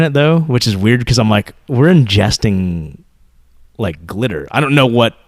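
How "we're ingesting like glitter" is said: slow disbelief. Retold or imitated. Dad marveling at glitter ice cream.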